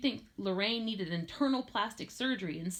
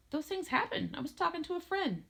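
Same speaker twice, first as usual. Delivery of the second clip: high-pitched, defensive back talk; defensive teen; 10 semitones higher than usual. A narrator's reading. Teenager excusing a busy line to father, high defensive retort. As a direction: brush off the complaint in a raised voice.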